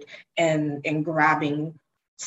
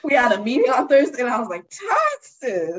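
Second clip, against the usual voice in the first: laughing; 7 semitones higher, 4 dB louder than usual. Retold or imitated. Laughing at tax meeting.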